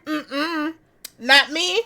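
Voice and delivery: normal talking voice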